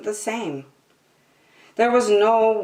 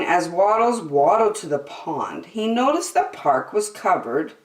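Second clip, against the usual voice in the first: ordinary delivery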